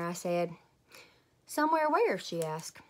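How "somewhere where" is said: pitched-up questioning mom; lightly voiced mom pressing the question; curious mother. A narrator's reading.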